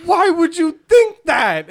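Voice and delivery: high, loud, exasperated question; hammed-up baffled outburst; mock incredulity. Jack challenging Rook about lava, incredulous.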